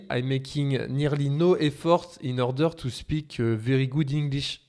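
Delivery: heavy, effortless French accent with hesitations; French-accented English. Shown as a demonstration. French teacher shows learners an untrained accent, thick French-accented English. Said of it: "when a French person speaks English, it will sound like".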